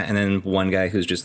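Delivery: ordinary delivery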